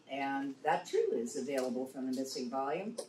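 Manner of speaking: ordinary talk, nothing marked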